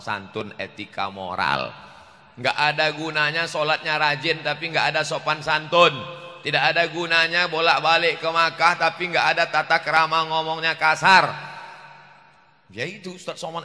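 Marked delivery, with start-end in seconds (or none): 2.4-11.3 louder, forceful preacher's delivery; preacher scolding bad manners with force; fiery preaching. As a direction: hammer the point home to the congregation.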